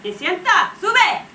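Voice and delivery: high, loud mock yell in Spanish; grandma yelling. Grandma calling the kids in from the window, high yell. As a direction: holler down from a window.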